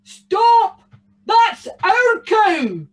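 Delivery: loud, high, drawn-out bellow; booming giant. A narrator's reading. Giant shouts after the cow thief, booming. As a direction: boom each word out slowly. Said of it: "booming from the hill".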